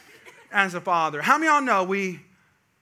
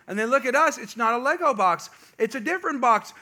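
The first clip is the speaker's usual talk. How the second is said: usual speaking voice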